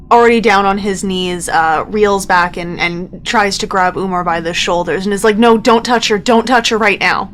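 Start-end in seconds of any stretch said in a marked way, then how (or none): 5.4-7.2 slightly raised, higher-pitched plea; urgent warning. Acted. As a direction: stop a friend, mildly alarmed. Bo warns Umar off Gertie.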